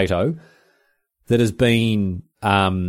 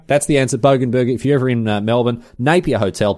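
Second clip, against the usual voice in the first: usual speaking voice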